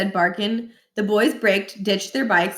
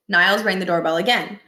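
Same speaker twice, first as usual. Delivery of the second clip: plain reading voice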